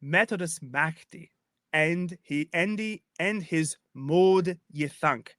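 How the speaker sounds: put-on slow, measured Old English verse; Old English recitation. Shown as a demonstration. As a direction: recite the Old English in deliberate chunks, pausing between phrases. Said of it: "look down at the second line".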